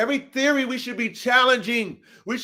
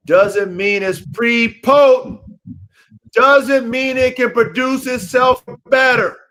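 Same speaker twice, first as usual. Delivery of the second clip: louder, slower, leaning hard on prepotent; emphatic stress; 3 semitones higher, 8 dB louder, slower than usual. Shown as a demonstration. Hammering the point home to dog breeders, with heavy stress. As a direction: punch prepotent out loud and slow so it lands. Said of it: "I gotta put the emphasis on that".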